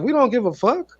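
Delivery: high mock voice asking who cares. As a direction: pitch way up to mock a careless attitude. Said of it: "I'd be like".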